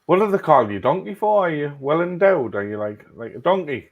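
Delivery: high, loud cheeky question. Retold or imitated. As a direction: blurt it out, loud and nosy. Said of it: "so me being me went".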